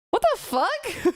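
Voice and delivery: high, drawn-out disbelief; can't believe what they just heard; shocked. Real, not acted. Shocked reaction to lore.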